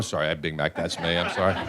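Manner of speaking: a real embarrassed laugh; laughing aside. Real, not acted. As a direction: chuckle at your own slip. Laughing at own Big Mac slip.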